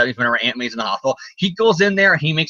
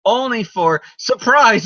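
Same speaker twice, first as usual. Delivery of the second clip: usual speaking voice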